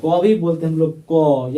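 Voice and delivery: teacher showing क is क plus अ; ka sound demo. Shown as a demonstration. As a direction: let the a ring after क. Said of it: "क plus अ से ये होता है क".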